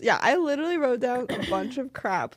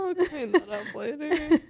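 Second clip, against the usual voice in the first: laughing; 4 semitones higher than usual. Real, not acted. Laughing after a flub.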